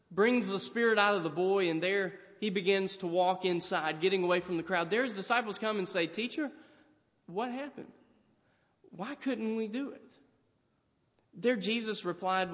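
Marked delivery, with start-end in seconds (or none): 6.1-10.2 soft, hesitant question with long pauses; subdued questioning. Retold or imitated. Disciples come to their teacher after failing, subdued questioning. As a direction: imitate puzzled followers asking softly.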